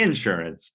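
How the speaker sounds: INsurance, low, first syllable stressed; Southern first-syllable stress. Retold or imitated. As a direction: lean on the IN of insurance. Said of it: "the emphasis on words".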